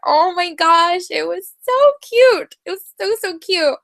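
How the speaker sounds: squealing high over a cute scene; gushing high. Real, not acted. Friend gushing to co-host about the couple, high and excited.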